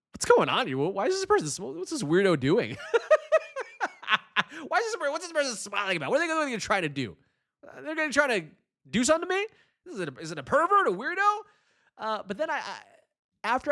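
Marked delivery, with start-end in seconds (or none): 0.1-2.8 mock suspicion. Speaker retelling seeing a smiling stranger, mock suspicious. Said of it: "at first I was like". 2.8-4.6 high burst of laughter; real laugh. Real, not acted. Speaker laughing at their own story of a smiling stranger. 4.7-11.4 higher, fast suspicious rant; mock paranoia. Speaker voicing past suspicion of a smiling stranger, higher and fast. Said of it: "at first I was like".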